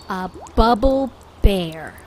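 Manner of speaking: ordinary delivery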